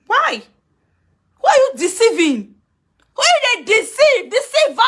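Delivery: high, loud accusing shout; angry outburst. Real, not acted. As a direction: call them a deceiver, furious. Angry rant at critic on live.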